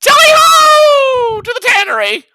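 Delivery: high, slow, loud goblin cry; rally the party as a goblin; high drawn-out goblin shout. Acted.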